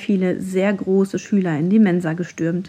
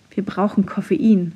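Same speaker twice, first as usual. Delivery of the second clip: ordinary delivery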